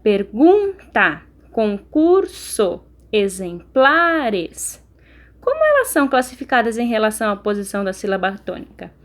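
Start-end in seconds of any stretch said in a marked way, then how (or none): 0.0-4.8 very slow, each word said apart; teacher reading the word list slowly aloud. Shown as a demonstration. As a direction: read the words out slowly for the class. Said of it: "leia estas palavras em voz alta".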